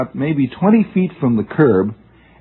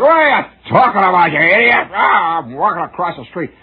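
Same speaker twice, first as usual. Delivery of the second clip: pedestrian yelling back; 5 semitones higher than usual. Retold or imitated. Jaywalker yelling back at the cab driver, indignant. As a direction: snap back like an offended pedestrian. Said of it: "and he said".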